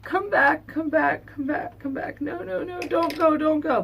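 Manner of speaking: high pleading cries that start loud and fade; retold desperate screaming. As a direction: beg him to come back in a high voice. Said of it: "I'm still screaming".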